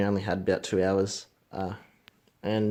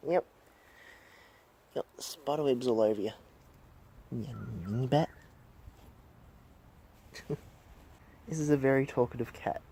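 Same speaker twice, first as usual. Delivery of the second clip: ordinary talk, nothing marked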